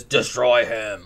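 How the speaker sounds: drawn-out, menacing mock command; satanic command. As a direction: stretch it out slowly like an evil figure. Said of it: "Like, like that".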